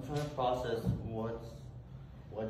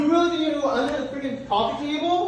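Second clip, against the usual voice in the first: furious yell; 13 semitones higher, 14 dB louder than usual. Acted. Catching his girlfriend's hidden lover, he yells high and loud. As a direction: bellow it as you catch them. Partner discovers hidden man, yelling.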